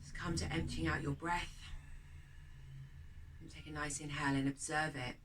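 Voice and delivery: quiet guided breathing meditation voice. Acted. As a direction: lead the breath calmly and gently.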